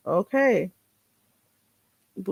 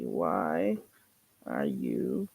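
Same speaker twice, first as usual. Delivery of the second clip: pitched far lower and softer; deep mock voice; 12 dB quieter, much slower than usual. Echoing the caller's question, pitched very low and soft. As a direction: drop the voice way down like a slowed recording.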